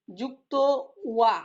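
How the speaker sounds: ordinary talk, nothing marked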